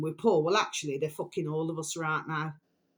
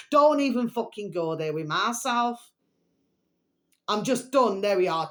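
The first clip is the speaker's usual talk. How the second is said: loud, higher, fed-up snap; angry outburst; 3 semitones higher, 5 dB louder than usual. Real, not acted. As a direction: blow up and shut it down. Angry rant about world corruption.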